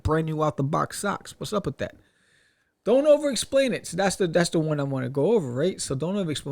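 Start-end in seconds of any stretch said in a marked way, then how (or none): 2.8-3.9 high, loud heading read; punchy heading. Acted. Vlogger reading the next tip heading, high and loud.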